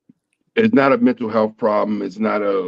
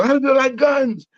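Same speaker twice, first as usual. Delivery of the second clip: much higher crowd-warmer call; hyping the crowd; 13 semitones higher than usual. Retold or imitated. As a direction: rile up the room like the opening act. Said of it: "but he go".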